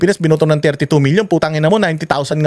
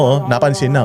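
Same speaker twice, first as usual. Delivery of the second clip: ordinary delivery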